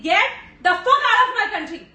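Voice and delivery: loud, high shout, leaning on "out"; defiant shout. Acted. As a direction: yell it slowly, driving "out" home. Final defiant demand in court. (ललकारते हुए "out" पर ज़ोर)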